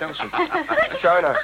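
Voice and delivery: laughing out the names in delight; joyful laugh. Acted. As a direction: greet them through a happy laugh. Joyful reunion with friends.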